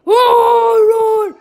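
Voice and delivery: long, high, very loud cry; startled yell. Real, not acted. Player startled by the game, loud high yell. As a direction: let out a loud held yell of shock.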